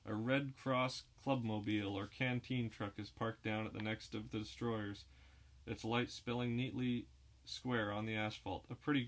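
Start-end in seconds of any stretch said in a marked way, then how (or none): none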